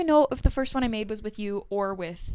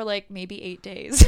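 ordinary talk, nothing marked

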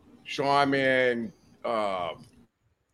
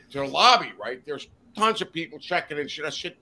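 ordinary delivery

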